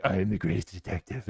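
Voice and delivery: Batman voice. Retold or imitated. To his girlfriend's game chat, a lightly done low Batman voice. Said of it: "Batman voice".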